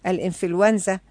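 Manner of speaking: normal talking voice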